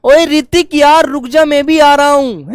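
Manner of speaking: calling out. Retold or imitated. Teacher acting a kid calling to a friend ahead. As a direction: call after a friend who is walking off. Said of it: "आप उसको आवाज मारते हैं".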